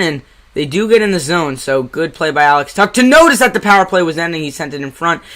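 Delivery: live hockey call, brisk; play-by-play commentary. Acted. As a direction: call the game like a broadcaster.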